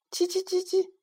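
high, squeaky bird chirping; high sparrow twitter. A narrator's reading. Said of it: "麻雀妈妈在屋檐上逗小麻雀玩儿".

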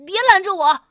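loud furious shout. A narrator's reading. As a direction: yell at your friend to back off. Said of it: "我对巴尔纳贝吼道". (大声怒吼)

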